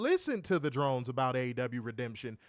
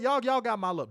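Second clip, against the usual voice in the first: ordinary talk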